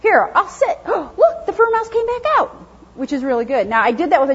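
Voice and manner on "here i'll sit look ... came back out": high, rising excited dog voice, gasping at the end; eager dog voice. Retold or imitated. Dog thrilled its fur mouse came back, high excited voice. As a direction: play the thrilled dog seeing its toy come back. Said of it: "And then the dog goes".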